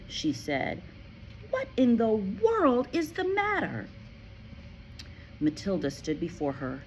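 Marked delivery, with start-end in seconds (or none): 1.5-3.9 high, gentle worried question; concerned teacher. Acted. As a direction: kind woman checking on a child. Miss Honey concerned.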